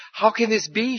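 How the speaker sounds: high, sudden blurt. Acted. Mary startled into speaking to the angel, blurted high. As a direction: burst out before she can stop. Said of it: "she blurted".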